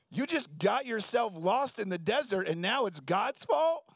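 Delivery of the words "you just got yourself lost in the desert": ordinary delivery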